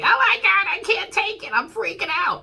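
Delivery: high, loud mock panic; panicked mind. Voicing the Taurus mind freaking out, high mock panic. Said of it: "It's your mind that's saying".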